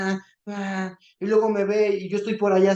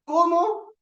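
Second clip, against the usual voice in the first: sharp high disbelieving ¿Cómo?; stunned, pitch jumps up; surprised; 11 semitones higher than usual. Real, not acted. Preacher reacting to his daughter's apology, surprised.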